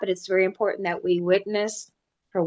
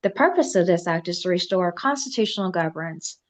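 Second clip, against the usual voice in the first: solemn reading rising to louder oratory; formal proclamation building to forceful; 7 dB louder than usual. Acted. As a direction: build from measured decree to rallying demand. Reads a formal declaration.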